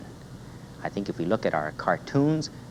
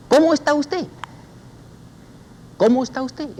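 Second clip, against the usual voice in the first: much higher, lilting Cómo está usted; high sing-song greeting; 6 dB louder than usual. Shown as a demonstration. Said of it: "repítala, repita".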